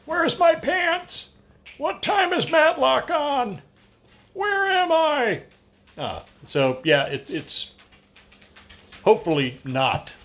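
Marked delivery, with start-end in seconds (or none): 0.1-5.4 put-on very high, slow, confused old man; wheezy old man voice. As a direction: croak it like a doddering grandpa. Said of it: "just a little wheezy old man car".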